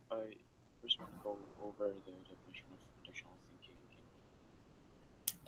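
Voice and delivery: very soft, barely audible; too quiet. Real, not acted. Student starts presenting to the class, far too quietly. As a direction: speak far too softly to be heard.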